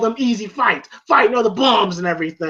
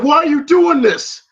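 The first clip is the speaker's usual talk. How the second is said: louder, higher mock demand; insistent demand; 9 semitones higher, 5 dB louder than usual. Rocky pressing Mickey for an answer, loud demand. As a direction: raise the voice, imitating a frustrated fighter. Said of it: "I said".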